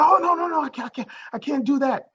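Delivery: a frightened climber blurting out that he can't let go; panicked refusal. Acted. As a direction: beg off in terror, rushing the words.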